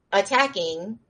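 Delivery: ordinary talk, nothing marked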